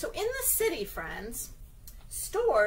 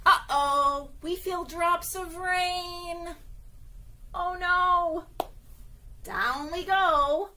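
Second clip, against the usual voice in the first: mock alarm; 6 semitones higher, 6 dB louder, much slower than usual. Rain in story read aloud.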